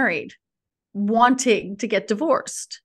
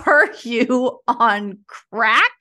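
incredulous; 3 dB louder, much slower than usual. Retold or imitated. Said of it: "And I was like".

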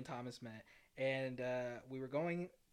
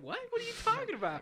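surprised; 6 dB louder, faster than usual. Retold or imitated. Retold stunned reply.

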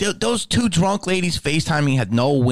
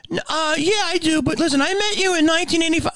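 imitating a clueless fan, much higher; dopey nostalgic fan, pitched up; faster than usual. Fan ignoring the smell, high dopey voice.

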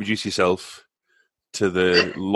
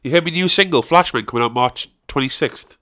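cheeky loud greeting; 6 semitones higher, 4 dB louder than usual. Pretend. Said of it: "I'll be like".

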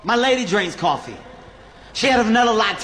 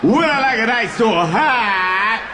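nervous repeat; 2 semitones higher, slower than usual. Shown as a demonstration. Comic stalling at the barista, slow bewildered echo. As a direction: drag the question out, lost. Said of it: "when I get nervous, I just repeat shit".

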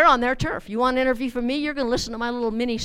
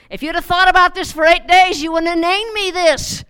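much higher and louder, whiny protest; complaining kid; 6 semitones higher, 7 dB louder than usual. Retold or imitated. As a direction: whine at your parents about your name. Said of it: "kids have probably wished".

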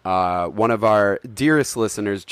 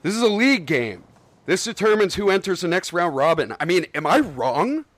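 raised, insistent bowling-league stickler; indignant Walter; 6 semitones higher than usual. Acted. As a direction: lay down the rules, getting worked up.

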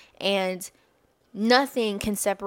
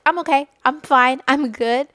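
automatic brush-off; 5 semitones higher, 8 dB louder than usual. Retold or imitated. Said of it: "it automatically just comes out like".

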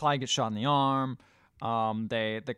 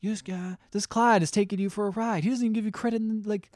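mock indignant father, high and rushed; worked-up retold rant, speeding up; crappy dad; 9 semitones higher, 6 dB louder, faster than usual. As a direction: imitate a dad griping, building louder and higher. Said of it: "dad starts to be kinda crappy".

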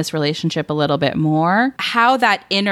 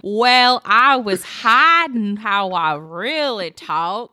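slow put-on confession in a mock voice; mock secret-accent confession, slow and hammed-up; horrible fake voice; 3 semitones higher, slower than usual. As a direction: ham up a fake reveal, drawn out. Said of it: "You should have been like, some horrible".

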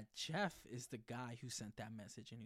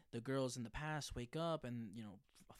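normal talking voice

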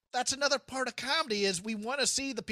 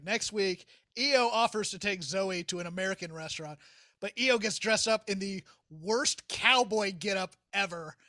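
ordinary talk

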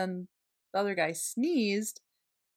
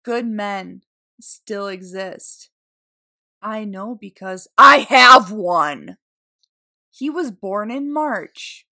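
slow, swelling louder, mock-sentimental; sappy mock reading; 12 dB louder, slower than usual. Reading the sweatshirt slogan aloud in a gushing mock-sentimental voice. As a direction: read it like a gushing caption. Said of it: "the writing that is on that sweatshirt".

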